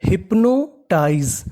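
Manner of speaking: Hypnotise stretched out slowly, each syllable spelled clearly. Shown as a demonstration. Teacher spelling a new word for students, drawn out slowly. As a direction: draw out hypnotise for learners. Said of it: "तो meaning बनी".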